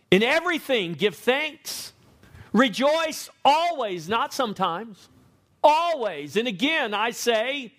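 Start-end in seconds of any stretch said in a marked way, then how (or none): 0.1-4.1 higher, slow, emphatic reading of "Rejoice always"; a preacher lifting the verse high and slow; emphatic scripture. Acted. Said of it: "he's pretty emphatic there". 5.6-6.4 "Always" stressed high and loud; single word held up high; emphatic always. Acted. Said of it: "he's pretty emphatic there".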